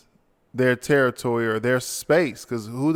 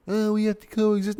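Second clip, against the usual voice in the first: much higher put-on mocking voice; mocking whine; 10 semitones higher than usual. Mimicking people who argue about living with animals, mocking. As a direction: parody the plea in a high voice. Mimicking the opposing side's plea.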